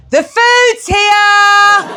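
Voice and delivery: high, very slow, loud call; fake cheerful shout. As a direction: shout it to an imaginary crowd. Shouts into the empty apartment.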